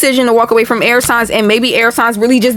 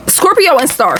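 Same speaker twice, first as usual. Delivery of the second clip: ordinary talk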